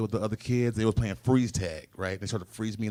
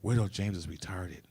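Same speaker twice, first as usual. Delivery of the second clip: sweet mom; 4 semitones lower, 7 dB quieter than usual. Retold or imitated. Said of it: "so innocent, so sweet".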